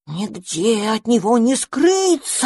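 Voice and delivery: slow, spooky storyteller. Acted. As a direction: draw it out to scare them. Scary story climax.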